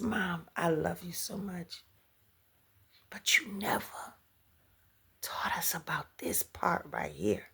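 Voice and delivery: soft whispered daughter's words. Retold or imitated. Daughter gently telling her mom, whispered. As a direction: hush it like a gentle confession.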